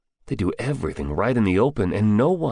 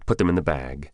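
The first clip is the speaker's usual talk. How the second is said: usual speaking voice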